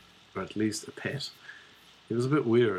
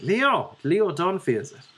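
higher-pitched Dublin head-office voice; Dublin accent; 7 semitones higher than usual. Retold or imitated. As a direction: introduce yourself in a put-on Dublin accent. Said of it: "I could do a Dublin one".

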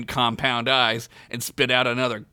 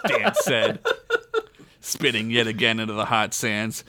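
ordinary talk

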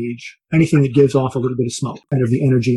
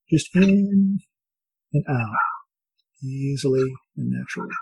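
soft spa whisper; 6 dB quieter than usual. Acted. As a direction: ease them gently into calm. Leads a guided breathing meditation.

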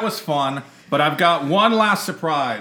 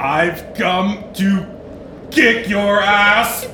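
slow and menacing, louder at the end; hammed-up Robert Frost; 2 semitones higher, 3 dB louder, much slower than usual. Ghost poet threatening co-host, slow and hammed-up. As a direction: threaten her like a spectral brawler. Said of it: "Robert Frost".

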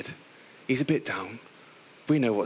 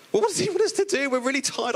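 dismayed disciples; 10 semitones higher than usual. Retold or imitated. Disciples protesting at being told to feed the crowd, indignant. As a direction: squeal the outrage.

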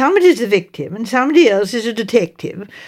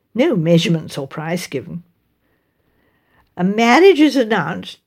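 plain reading voice

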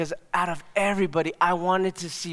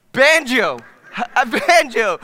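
loud, high call of a name, laughing; excited calling out; 6 semitones higher, 9 dB louder, much slower than usual. Retold or imitated. Pastor spotting his old friend on campus, delighted call.

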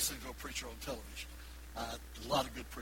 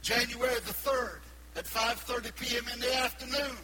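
raised, ringing preacher voice; preaching; 9 semitones higher, 4 dB louder than usual. Real, not acted. Preacher to his congregation, ringing and raised.

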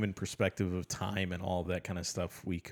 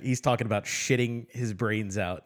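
normal talking voice

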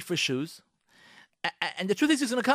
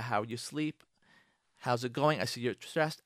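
lower, softer caring question; softly connect with a stressed wife; gentle check-in; 5 semitones lower, 4 dB quieter than usual. Shown as a demonstration. Husband gently checking in on his wife, softer and lower.

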